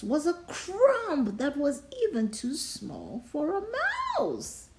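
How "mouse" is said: ordinary delivery, nothing marked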